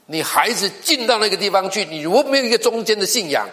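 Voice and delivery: high, loud, urgent pulpit warning; preaching at full force. Acted. Pastor warning parents in the pews, high and loud preaching. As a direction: hammer the warning home to the congregation.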